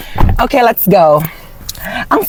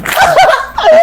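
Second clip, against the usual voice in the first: high cackle. Acted. Tita laughing at own joke.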